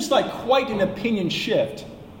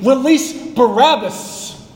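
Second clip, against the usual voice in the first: slow, louder, higher demand; mob shout; 6 semitones higher, 6 dB louder, slower than usual. Retold or imitated. Preacher voicing the Sanhedrin's demand to Pilate, slow loud shout. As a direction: shout it like the chief priests stirring a mob. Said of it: "So you had the Sanhedrin shouting".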